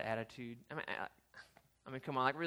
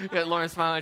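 laughing through the words; 5 semitones higher, 5 dB louder than usual. Real, not acted. Pastor laughing at himself before the congregation, laughing. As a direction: laugh at your own silly voice.

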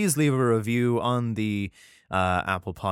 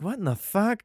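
higher, baffled question; mock baffled; 9 semitones higher than usual. Philistines hearing the riddle, mock baffled higher question. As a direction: imitate confused Philistines. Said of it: "they're like".

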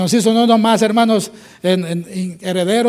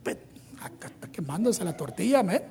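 much softer, chuckling urgency; hushed urgent complaint; 12 dB quieter than usual. Retold or imitated. Marta hushing at Jesus about burning tortillas, urgent. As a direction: complain under your breath.

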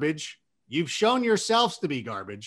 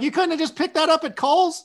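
mock disbelief; 10 semitones higher, 3 dB louder than usual. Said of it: "You're like".

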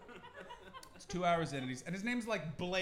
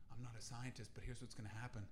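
deadpan expert; 10 semitones lower, 11 dB quieter than usual. Retold or imitated. Mock expert verdict.

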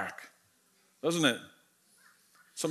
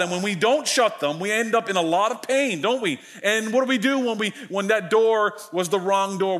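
usual speaking voice

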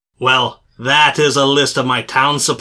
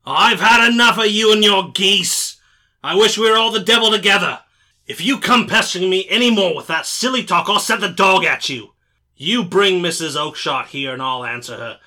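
much higher, furious trader shouting; fierce anger; 7 semitones higher than usual. Acted. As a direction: threaten him with the dog. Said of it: "shaking his fist fiercely at the cringing figure".

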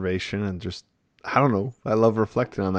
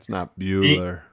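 slow, monotone Bueller call; deadpan, drawn-out name call; monotone Bueller roll call; 4 dB louder than usual. Acted. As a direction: drone it out slowly like a bored roll call. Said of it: "like, monotone robot".

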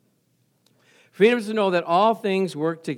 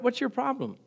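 ordinary delivery

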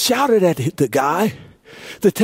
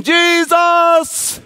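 crying out; 9 semitones higher, much slower than usual. Acted. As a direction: call out across the crowd. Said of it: "someone saying".

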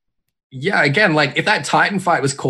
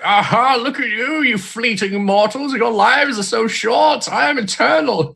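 high, slow grandiose god voice; vast cosmic voice; 11 semitones higher, slower than usual. Retold or imitated. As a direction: gloat like an ancient being. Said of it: "he was like".

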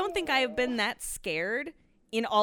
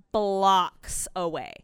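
ordinary delivery

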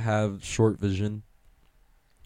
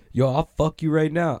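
mock tough threat; 6 semitones higher, 4 dB louder than usual. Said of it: "I'll be like".

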